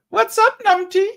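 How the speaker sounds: very high, loud falsetto; playful greeting. Shown as a demonstration. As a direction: call out teasingly in a silly high voice. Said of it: "That's how I'm gonna do it".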